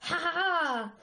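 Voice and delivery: hammed-up triumphant laugh; mock laugh. Argile gloating over the defeated queen with a mock laugh. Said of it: "s'esclaffa Argile".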